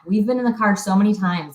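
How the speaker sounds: usual speaking voice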